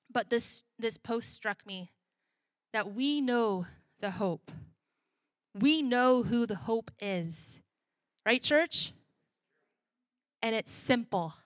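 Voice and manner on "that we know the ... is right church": preaching. Acted. Preaching to congregation.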